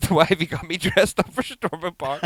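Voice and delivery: high, loud mock whine of protest; indignant child. Daughter dressed up for the park, indignant. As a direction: imitate a kid raging at Daddy.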